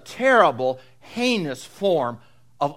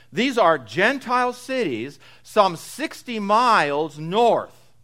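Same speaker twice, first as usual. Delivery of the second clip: ordinary talk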